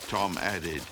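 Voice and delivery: ordinary delivery, nothing marked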